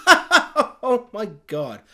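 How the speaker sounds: mock disbelief through a laugh; acted incredulous laughter. Writer reacting to the shock phone call, acted incredulous laugh. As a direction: laugh into a slow, drawn-out line.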